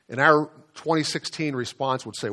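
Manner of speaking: ordinary talk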